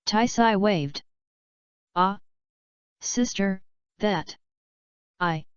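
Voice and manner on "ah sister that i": stuttering. A narrator's reading. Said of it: "Bulma stuttered".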